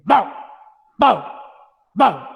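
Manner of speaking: short firm barks; dog bark. Shown as a demonstration. Teacher shows the right dog sound, firm barks. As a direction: bark with full energy. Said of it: "So this is how you must do".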